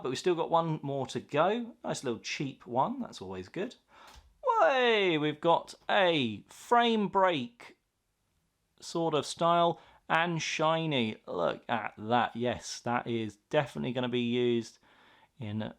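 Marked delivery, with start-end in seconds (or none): 4.6-11.2 thrilled shout at a lucky pull; excited. Real, not acted. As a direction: burst out with delight, then savour it slowly. Shiny frame break card revealed.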